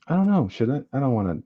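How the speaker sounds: usual speaking voice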